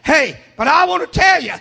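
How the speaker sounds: ordinary talk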